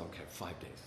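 very soft, low reply; acted-out small, subdued agreement; sheepish concession. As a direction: give in meekly, barely audible. Retold sheepish promise to sponsor.

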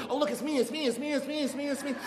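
put-on high, giggly little-kid voice; delighted small child. Little boy in the store mirrors, giggly and high. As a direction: squeal like a first-grader seeing himself in mirrors. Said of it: "mirrors facing each other and go".

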